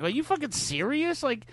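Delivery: can't believe anyone fights over this; exasperated disbelief. Retold or imitated. Host mocks friends who quit over wrestling, high incredulous disbelief.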